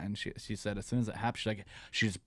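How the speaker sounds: normal talking voice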